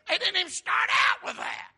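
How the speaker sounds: very high, loud preacher's exclamation; amazed shout. Real, not acted. Preacher marvelling at twelve baskets of leftovers, amazed shout. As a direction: cry it out in disbelief.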